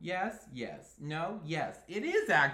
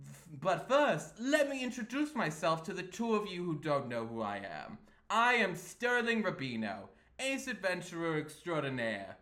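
flamboyant Sterling; 5 dB louder than usual. Acted. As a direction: introduce yourself with theatrical swagger. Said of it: "he's kind of, like, flamboyant".

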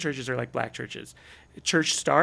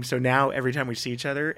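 ordinary delivery

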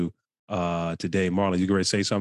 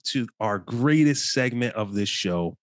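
raised, upbeat show-host voice; host intro; 5 semitones higher, slower than usual. Acted. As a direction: welcome the listeners like a radio host. Host opens guest segment.

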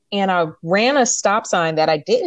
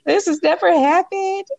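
mock crying plea; 12 semitones higher than usual. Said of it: "boo-hoo crying already".

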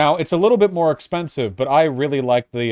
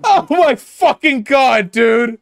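high, loud disbelieving yell; exasperated outburst; 10 semitones higher, 6 dB louder, slower than usual. Real, not acted. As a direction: blurt it out in disbelief. Reacting to clip laugh.

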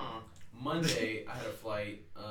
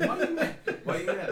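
laughing tease; 10 semitones higher, 10 dB louder than usual. Pretend. Teasing friend after date joke.

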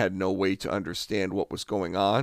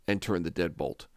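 normal talking voice

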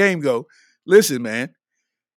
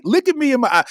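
insistent plea; 7 semitones higher, faster than usual. Acted. Repeats plea, much higher.

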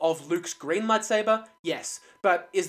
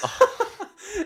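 burst of high laughter; 5 dB louder than usual. Real, not acted. Laughs at map joke.

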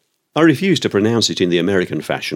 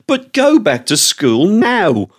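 high, slow, stern command; a slow, high mock-stern order; schoolmaster scolding; 10 semitones higher, 3 dB louder, slower than usual. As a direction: send them back to class. Scolding the serving staff.

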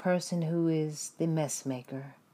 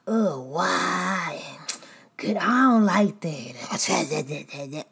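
mock whine; 4 dB louder, much slower than usual. Complainer whining.

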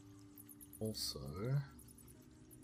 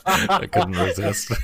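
laughing; 13 semitones higher, 14 dB louder than usual. Real, not acted. GM laughing at his prank.

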